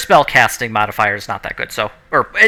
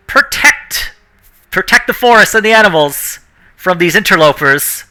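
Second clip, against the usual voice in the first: mildly raised, higher commanding cry; dryad's shout; 6 semitones higher than usual. Acted. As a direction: call out as a dryad rallying her protector. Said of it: "the Dryad's just gonna shout".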